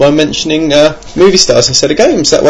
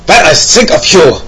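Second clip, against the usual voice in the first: hammed-up German accent, higher pitched; 4 semitones higher, 4 dB louder than usual. Host quoting a listener's reasoning, mock German accent. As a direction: read it as a comic German. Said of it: "I could do a German accent".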